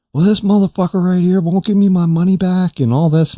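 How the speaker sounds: high, heated mock rant; imitated going off. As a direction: imitate an outraged customer complaining at full tilt. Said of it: "he's going off".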